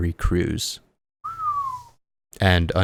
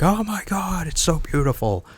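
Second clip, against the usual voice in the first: much higher, gushing squeal of delight; Terry Crews freaking out; 11 semitones higher than usual. Retold or imitated. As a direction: gasp it out like a thrilled fan seeing a gift. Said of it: "freaking out".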